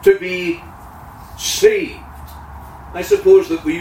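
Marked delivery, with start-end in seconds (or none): 0.0-2.0 "to be saved" lifted high; stress on saved. Shown as a demonstration. Preacher asking what it means, lifting to be saved. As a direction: raise "to be saved" up. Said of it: "what it means".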